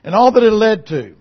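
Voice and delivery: loud, rising sermon cadence, pounding each phrase; preaching at full force. Acted. Preacher to his congregation about Joseph, preaching at full force. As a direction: preach it out over the congregation, building.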